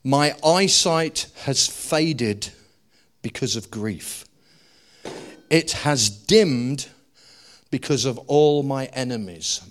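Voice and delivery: slow, weighty reading of the verse; slow scripture reading. Shown as a demonstration. Preacher reading David's lament slowly to the church. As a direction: read it out slowly, letting each phrase sink in. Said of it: "Watch what he says".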